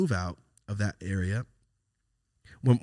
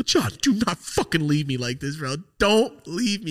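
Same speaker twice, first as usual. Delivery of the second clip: panicked begging; 9 semitones higher, 6 dB louder than usual. Retold or imitated. Begging uncle not to leave.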